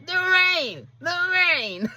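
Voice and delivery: high, strained creature voice, imitated; Gollum. Wrestler begging Okada for the ring, creature impression. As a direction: plead for it in a high-pitched creature croon. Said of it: "full on Gollum".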